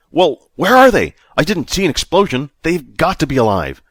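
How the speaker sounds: high, loud and desperate; frantic shouting. A narrator's reading. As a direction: yell it in panic. Vic frantic over lost crew.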